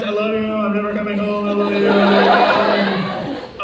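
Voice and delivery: loud, laughing goodbye to friends; laughing farewell. Retold or imitated. Calling all his friends before doom, loud laughing farewell. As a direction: gush it through laughter like you're doomed. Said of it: "call all my friends".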